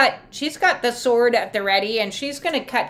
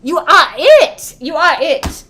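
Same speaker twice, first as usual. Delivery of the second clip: louder and drawn out, rising; loud slow emphasis; 4 semitones higher, 9 dB louder than usual. Shown as a demonstration. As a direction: declare it slowly, loud. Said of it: "you already have it".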